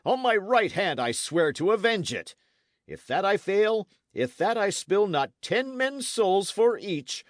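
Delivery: loud, high-pitched vow of vengeance; rage and grief. A narrator's reading. Knight swearing vengeance for his slain men, furious. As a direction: swear revenge in mounting fury, hammering each phrase. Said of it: "convulsed with rage and grief".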